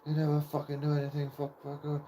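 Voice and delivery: lightly voiced mocking swear rant; half-hearted mock tirade; imitating mum swearing. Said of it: "she starts swearing at me".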